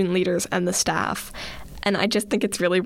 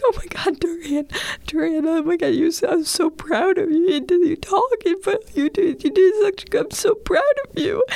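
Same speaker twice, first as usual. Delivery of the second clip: sobbing, high-pitched; 10 semitones higher than usual. Retold or imitated. Leader sobbing her pride to a shy friend, high and sobbing. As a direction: blubber it out way up high, overwhelmed with pride. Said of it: "I'm sobbing".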